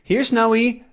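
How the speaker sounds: high call to the dog. Acted. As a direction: call him back. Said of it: "He's whistling for Snowy".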